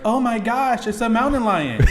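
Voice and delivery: high, loud mock-scared cry of surprise; mock panicked scream. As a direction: play the startled woman, hammed up. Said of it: "She was like".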